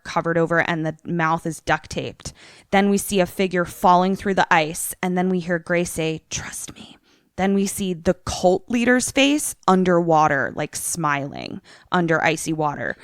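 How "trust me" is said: very soft whispered imitation; eerie whisper. Grace's voice after a fall through ice, eerily whispered. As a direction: whisper it like a horror trailer line.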